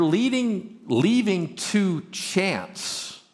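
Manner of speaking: very slow, weighted "leaving to chance"; drawn out slowly. Acted. Slows to drive the point home.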